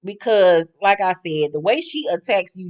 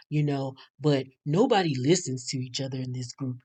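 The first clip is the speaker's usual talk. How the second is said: Damon imitation; 3 semitones lower, 8 dB quieter than usual. Mocking Damon explaining himself to Andy, low and soft. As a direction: murmur it low and meek, barely there. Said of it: "And he was like".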